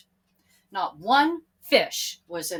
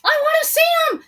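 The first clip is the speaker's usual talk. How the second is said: much higher, fast, urgent; eager; 12 dB louder, much faster than usual. A narrator's reading. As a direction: blurt it out longing. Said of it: "said Peter".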